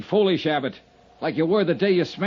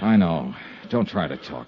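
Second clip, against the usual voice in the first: low, hushed and tender; calm him as he fades; gentle comfort; 13 semitones lower, 3 dB quieter than usual. Acted. Comforting the dying man.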